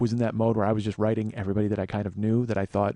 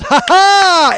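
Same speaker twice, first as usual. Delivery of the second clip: big burst of a laugh, high and loud; loud delighted laugh; 16 dB louder than usual. Retold or imitated. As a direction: bark out a thrilled "ha ha". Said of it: "director just goes".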